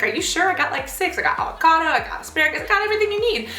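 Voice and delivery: high, pleading list that climbs to the end; coaxing. Retold or imitated. Pushing rolls on friend.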